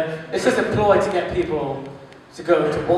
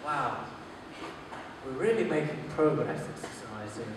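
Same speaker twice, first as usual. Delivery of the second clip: soft, low musing aside; thinking to himself; 6 semitones lower, 15 dB quieter than usual. Retold or imitated. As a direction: muse it quietly, far below the usual voice. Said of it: "just think to myself".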